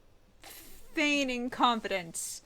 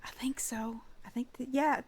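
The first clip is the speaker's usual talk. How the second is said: soft unvoiced whisper; 4 semitones higher, 4 dB quieter than usual. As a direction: keep it to a hush. Players whisper about the stranger.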